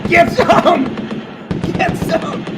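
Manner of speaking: rally the troops; cheering shout. Acted. Streamer cheering donors like soldiers, high loud battle cry.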